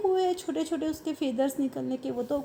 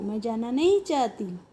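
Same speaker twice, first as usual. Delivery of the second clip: normal talking voice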